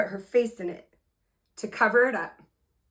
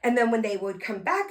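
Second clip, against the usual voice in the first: ordinary delivery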